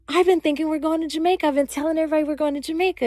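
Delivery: high-pitched rapid-fire protest; excited disbelief. Retold or imitated. Boyfriend was telling everybody about Jamaica, excited and incredulous. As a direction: imitate him rattling it off, high and astonished. Boyfriend reacts to surprise trip.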